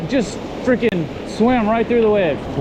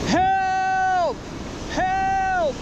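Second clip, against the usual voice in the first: mock cry for help; 11 semitones higher, 4 dB quieter than usual. Stranded on beach, joking cry.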